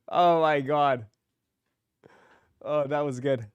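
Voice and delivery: ordinary talk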